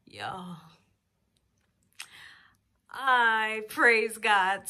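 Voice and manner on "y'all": whispered. Real, not acted. Awed after good news.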